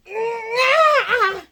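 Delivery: high, drawn-out strained shriek; mock battle scream. Acting out the Sparta kick, mock battle scream. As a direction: shriek as if kicking with all your might. Acts out kicking him downstairs.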